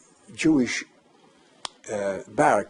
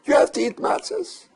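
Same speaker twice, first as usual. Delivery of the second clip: retold demand; 13 semitones higher, 4 dB louder than usual. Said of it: "And he said".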